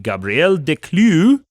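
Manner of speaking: put-on "Gabriel" in a slow Spanish accent, "Declieux" in a slow French accent; Spanish then French accent. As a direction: switch from a mock Spanish to a mock French accent mid-name. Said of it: "you pronounced his first name with a Spanish accent and then shifted to French".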